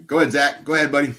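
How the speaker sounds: loud cheerful call. Real, not acted. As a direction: call him up, bright and loud. Calling out to Zach.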